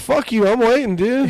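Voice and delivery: high, worked-up challenge; retold angry defiance. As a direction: act out daring someone to fight. Retelling own angry reply on phone.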